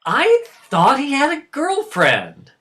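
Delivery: loud, high-pitched disbelief; stunned protest. Acted. Father stunned by son's secret.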